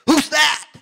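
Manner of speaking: loud, high mock alarm; acted high-pitched astonishment; startled shout. Villager retold startled by mirror.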